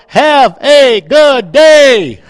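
loud, building to a drawn-out holler; mock yelling. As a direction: shout it like an all-caps text. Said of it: "what's he yelling at me for".

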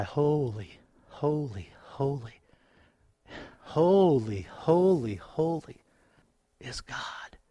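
hushed worship cry. Retold or imitated. Preacher reciting worship, hushed reverent whisper. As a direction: breathe out holy in awe. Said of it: "because I cry".

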